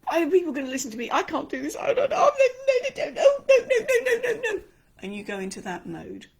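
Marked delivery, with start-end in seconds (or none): 0.0-4.6 frantic, high, loud panic with laughing sobs; panicked and scared. Acted. Speaker acting a scared performer before going on stage, frantic and high. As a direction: spiral into stage-fright panic. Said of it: "you are scared witless".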